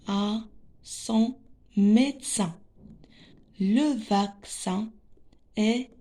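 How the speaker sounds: careful, word-by-word reading with pauses; deliberate classroom reading, each word set apart. Shown as a demonstration. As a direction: read for beginners, spacing out every word. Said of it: "on va lire les phrases".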